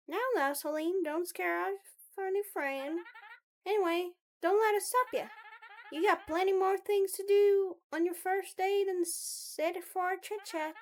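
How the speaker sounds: lightly voiced chatty student; Mabel.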